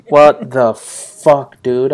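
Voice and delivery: drawn-out incredulous "what the fuck, dude"; slow, baffled mock voice; imitating a twelve-year-old's disbelief; mock neighbor girl.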